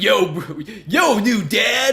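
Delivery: slow high bro shout; cocky boyfriend. Retold or imitated. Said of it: "He's like".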